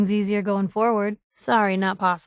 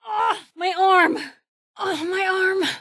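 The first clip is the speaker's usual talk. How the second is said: pained cry; 8 semitones higher than usual. Acted. Companion clutching her injured arm, crying out.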